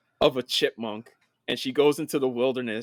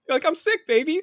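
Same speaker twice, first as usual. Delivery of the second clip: playful swagger; 12 semitones higher than usual. Acted. Playful brag about being sick.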